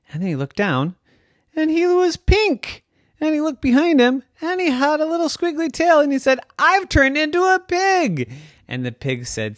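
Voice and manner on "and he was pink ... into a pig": high, fast, astonished squeal; high alarmed realisation; shocked discovery. Acted. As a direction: gasp it out in disbelief. Discovering he is a pig.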